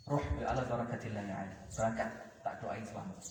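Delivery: very soft, low send-off; quiet blessing. Acted. The Prophet sending Ali off, hushed and low.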